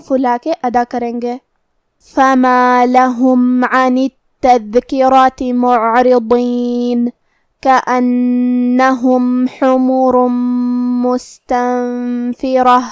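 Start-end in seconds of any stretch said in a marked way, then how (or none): 2.2-12.8 slow, drawn-out tajweed chanting; Quranic recitation. Shown as a demonstration. Teacher reciting the verse for students, slow melodic chant. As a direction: intone each verse slowly and melodically.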